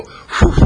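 loud airy whoosh; blowing whoosh. Acted. Butterfly shows rabbit how to blow, loud breathy whoosh. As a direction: blow out hard on the word.

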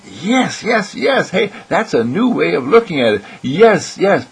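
high, giddy chorus of yeses; bubbling high yes, yes, yes; excited brain cells. Pretend. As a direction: squeal yes like tickled little brain cells. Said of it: "gray cells up there go wiggling around and saying".

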